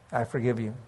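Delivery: barely voiced forgiveness; fading forgiveness. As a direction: let I forgive you die away.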